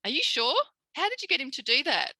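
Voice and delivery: lightly voiced astonished teacher; surprised teacher. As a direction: ask it amazed, as if it can't be true. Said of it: "And she goes".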